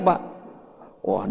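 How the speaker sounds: ordinary delivery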